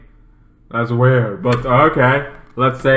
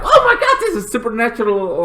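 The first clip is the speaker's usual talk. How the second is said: excited fan squeal; 10 semitones higher, faster than usual. Retold or imitated. Fans spotting something supernatural, giddy squeal.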